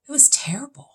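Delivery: a high, whispered it was terrible; whispered disgust at a bad film; whispered verdict. Real, not acted. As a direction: breathe it out like a confession. Recalling awful movie.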